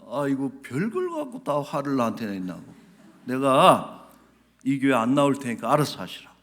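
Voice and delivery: loud, huffy retort of an offended churchgoer; acted indignation. Congregant storming out on the pastor, acted indignation. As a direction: imitate someone loudly quitting in a huff.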